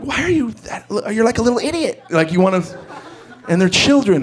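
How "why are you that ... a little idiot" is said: high mock scolding of a little kid; exasperated parent. Dad scolding his six-year-old, high mock exasperation. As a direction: squeak the insult up high. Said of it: "you look at him like".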